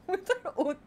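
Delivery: soft, breaking into laughter; laughing. Real, not acted. Streamer finishing a silly joke, laughing. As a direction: giggle through the words.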